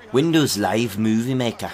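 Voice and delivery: Scottish-accented imitation, not quite convincing; attempted Scottish accent. Shown as a demonstration. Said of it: "in a Scottish accent".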